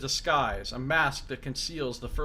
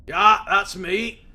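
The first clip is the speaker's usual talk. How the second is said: loud, raised voice of a wounded prisoner; gruff Mazov; 6 semitones higher, 11 dB louder, much slower than usual. Acted. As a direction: bark it at the intruder in surprise. Mazov voice, slow.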